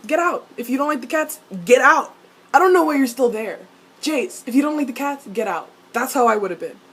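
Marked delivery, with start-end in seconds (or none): 0.1-2.1 high, loud dismissal rising to a shout; exasperated order. Acted. Fan telling off Jace over the cats, exasperated shouting. 2.5-5.3 exasperated scolding. Acted. Mock scolding of Jace continues.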